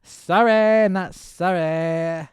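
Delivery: high, slow, breezy shrug of a voice; lightly mocking careless official; flippant mock apology. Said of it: "He was just like".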